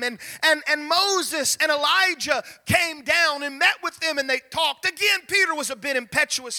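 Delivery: loud, ringing preacher's voice; preaching at full force. Acted. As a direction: proclaim it to the back pew. Preaching the transfiguration.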